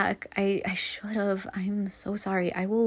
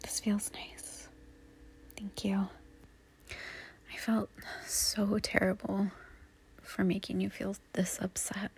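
soft tender whisper; 8 dB quieter than usual. Acted. Held by partner after a fight, soft tender whisper. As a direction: breathe it out close and gentle.

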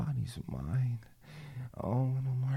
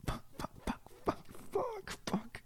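a string of high, strangled curses, climbing, then falling off; climax cries; 5 dB quieter than usual. Acted. Lover climaxing in her partner's arms, strangled high cries. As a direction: let the curses break up into cries. Peak of a sexual scene.

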